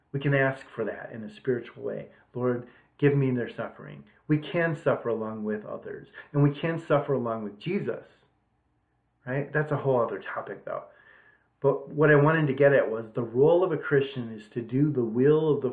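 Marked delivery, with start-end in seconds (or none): none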